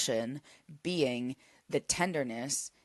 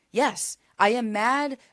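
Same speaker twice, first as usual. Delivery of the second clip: "Yes, I am mad" said higher and louder, leaning on mad; firm declaration; 7 semitones higher, 6 dB louder than usual. Shown as a demonstration. Coach modelling self-talk, firm raised declaration. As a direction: own the anger out loud with "Yes, I am mad". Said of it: "you have to be able to say".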